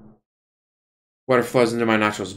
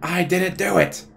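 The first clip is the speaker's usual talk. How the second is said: put-on kid protest; 6 semitones higher, slower than usual. Accused kid protests his innocence.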